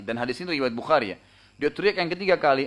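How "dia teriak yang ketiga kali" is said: usual speaking voice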